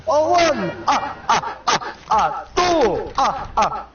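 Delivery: high Count counting with ah ah; mock Count counting. The Count counting victims, up high.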